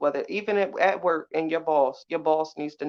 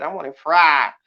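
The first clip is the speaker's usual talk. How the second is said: fried punched much louder; stress on fried; 10 dB louder than usual. Shown as a demonstration. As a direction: hit the word fried hard, louder on fried. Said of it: "that's how you got to say it".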